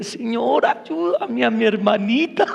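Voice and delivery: imitating a weepy plea; mock tearful prayer. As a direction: play-act crying to God, dragging the end. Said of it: "llorando le dije". (súplica llorosa fingida)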